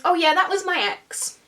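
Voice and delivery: high, airy put-on casualness; mock breezy reply. Date explaining the angry girl, high and breezy.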